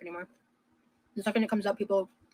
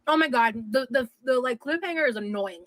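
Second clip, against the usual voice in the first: ordinary talk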